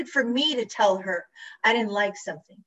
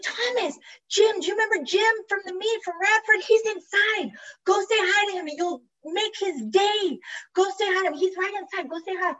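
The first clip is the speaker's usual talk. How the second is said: high, excited insistence; high-pitched, delighted coaxing; eager urging; 8 semitones higher than usual. Retold or imitated. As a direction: urge a friend along in a high, eager voice. Retold eager urging to greet friend.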